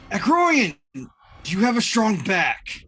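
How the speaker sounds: high, loud call across a battlefield; acted yell. Leto yelling at the Acroian in front of him, loud. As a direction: yell it out to get an ally's attention. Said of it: "he's going to yell at him".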